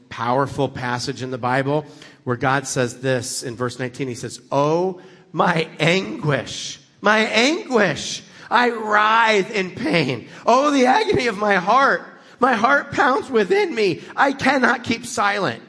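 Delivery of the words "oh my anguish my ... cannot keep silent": impassioned scripture cry, building high and louder; dramatic divine lament, rising in pitch; anguished God. Acted. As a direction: cry out the verse in drawn-out agony. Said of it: "God does not normally talk like that".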